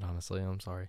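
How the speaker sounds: usual speaking voice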